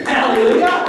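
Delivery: loud, high, rising shout of praise; jubilant exclamation. Retold or imitated.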